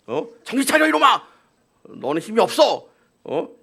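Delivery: high mock scolding of a child; hammed-up stern rebuke to a boastful kid. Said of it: "그런 마음으로". (장난스럽게 꾸짖는 목소리)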